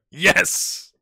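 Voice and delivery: loud, high burst of triumph; triumphant yes. Real, not acted. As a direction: punch the air with a shout. Deal accepted, cheers.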